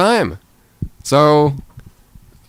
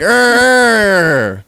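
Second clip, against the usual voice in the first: loud, much higher hammed-up "Arr"; mock monster roar; 9 semitones higher, 5 dB louder than usual. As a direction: roar like a cartoon monster crashing in.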